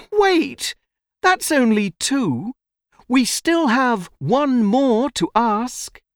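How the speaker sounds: put-on high-pitched, urgent child; boy crying out. A narrator's reading. Boy stops the tiger eating him, crying out. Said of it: "when the boy cries".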